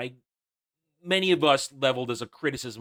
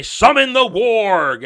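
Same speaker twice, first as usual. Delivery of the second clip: slow, loud, high mock orc command; 7 semitones higher, 8 dB louder, slower than usual. Reviewer mocking the orcs calling the beast, slow hammed-up command. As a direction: ham up a villain's order. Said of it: "the orcs say".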